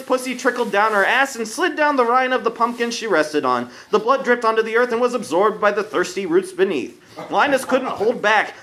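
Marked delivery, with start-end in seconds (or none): none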